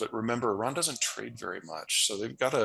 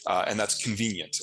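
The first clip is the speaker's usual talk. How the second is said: usual speaking voice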